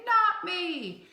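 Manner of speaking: high, playful answer; boys' line. Shown as a demonstration. Teacher voices the boys' answer from the phonics card, high and playful. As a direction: pop up bright and high like the boys. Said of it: "or boys say".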